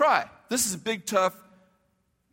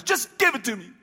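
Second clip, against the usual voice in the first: impatient outburst; 4 semitones higher than usual. Retold or imitated. Tony cuts in impatiently.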